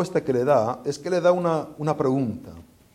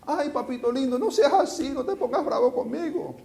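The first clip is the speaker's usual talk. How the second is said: high cooing plea; doting pleading voice; 9 semitones higher than usual. Retold or imitated. God imagined coaxing angry Jonah like a doting mother, high coo. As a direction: beg sweetly like a doting mother. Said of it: "puede ser que Dios está así con Jonás".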